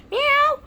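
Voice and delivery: very high loud mock meow; cat meow. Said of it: "making the cat noise".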